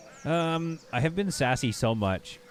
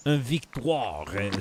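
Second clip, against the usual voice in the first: mock French accent; slower than usual. Playfully translating a victory, in mock French accent. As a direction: put on a showy French accent.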